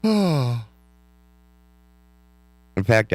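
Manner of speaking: sighing through a yawn. Real, not acted. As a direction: talk while stifling yawns. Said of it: "because I can't stop yawning".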